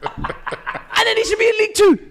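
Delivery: high laughing shout. Real, not acted. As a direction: yell it while laughing. Mocking shout about the referee.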